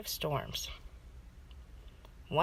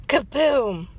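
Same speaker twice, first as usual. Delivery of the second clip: loud booming kaboom; thunder boom; 4 semitones higher, 9 dB louder than usual. A narrator's reading. Storyteller voicing the crash of thunder, loud boom.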